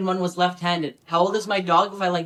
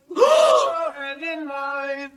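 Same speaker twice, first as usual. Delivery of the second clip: shocked gasp; 5 semitones higher than usual. Acted. A shocked gasp at the reveal. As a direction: gasp as if scandalised.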